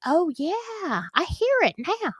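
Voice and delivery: high-pitched dawning recognition; mock realization. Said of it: "your brain connects. It's like".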